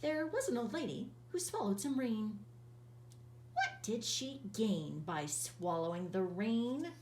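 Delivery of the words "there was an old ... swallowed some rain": ordinary delivery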